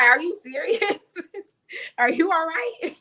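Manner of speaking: laughing, high disbelieving voice as God; God amused. Retold or imitated. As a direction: chuckle through an amused divine question. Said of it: "And God is like".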